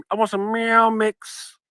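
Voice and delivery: high, slow pleading kitty voice; cat voice. Retold or imitated. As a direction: ask for food like a cat. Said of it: "I could say".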